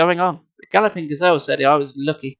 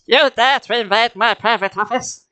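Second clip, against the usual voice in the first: a cheap cartoon villain demanding; discount Skeletor; 6 semitones higher than usual. A narrator's reading. Masked mutant confronts the intruding hero, cheap Skeletor snarl. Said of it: "discount Skeletor voice".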